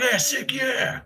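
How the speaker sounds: high, bright mimicked cheer; excited bloke. Mimicking Jamie happy to meet him, high and keen. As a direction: imitate a keen new bandmate.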